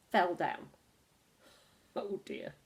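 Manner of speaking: plain reading voice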